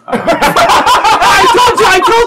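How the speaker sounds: very high, loud, stretched-out yell; vindicated kid shrieking. Acted. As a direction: screech it triumphantly like a child.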